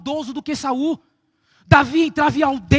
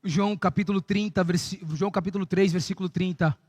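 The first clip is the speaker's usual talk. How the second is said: softer, lower, intimate preaching; soft, low altar call; quiet altar call; 7 semitones lower, 3 dB quieter than usual. Acted. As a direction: draw them in gently toward the altar.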